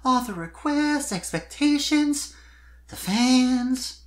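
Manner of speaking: put-on high, airy young filly voice, lifted well above the narrator; a gushing young pop star filly; Sweetie's voice. Sweetie complaining to Spike about fans, high filly voice.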